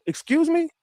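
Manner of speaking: very high incredulous excuse me; sky-high mock outraged question; indignant judge. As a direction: imitate a judge taken aback. Judge rebukes outburst in court.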